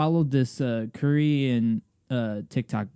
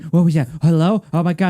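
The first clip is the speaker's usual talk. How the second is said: loud, raised, hammed-up surprise; mock shock; 6 semitones higher, 6 dB louder than usual. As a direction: play the startled prank victim. Acts out fake shock in prank.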